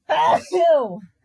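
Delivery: a real loud sneeze; one big sudden sneeze; sneezing. Real, not acted. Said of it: "I've ever sneezed on the podcast".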